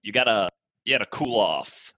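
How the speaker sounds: normal talking voice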